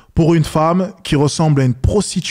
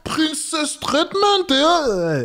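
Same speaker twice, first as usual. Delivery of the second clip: "Princess treatment" slow, much higher and airy; mock girly voice; 12 semitones higher, much slower than usual. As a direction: stretch "Princess treatment" out in a dreamy high girl voice. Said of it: "elles vont dire".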